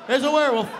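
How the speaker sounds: high, loud cries for help; hammed-up terrified shouting; panicked yelling. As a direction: yell the alarm in comic panic. Acts out screaming for help.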